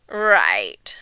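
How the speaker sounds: very low, drawn-out right; uneasy, stretched-out agreement; evasive child. A narrator's reading. Guilty child answers compliment.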